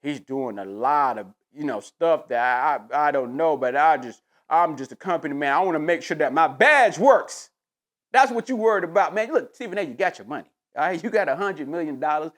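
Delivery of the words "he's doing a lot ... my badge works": lightly voiced corporate yes-man; mock company man. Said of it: "Stephen A is on Big Boy and say".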